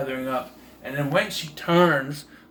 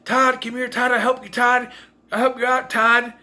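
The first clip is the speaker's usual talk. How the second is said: high mock yell calling out; a girlfriend shouting to Todd in the pool, high mock yell; 9 semitones higher, 3 dB louder than usual. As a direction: call out to Todd, pitched way up. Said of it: "and she's yelling".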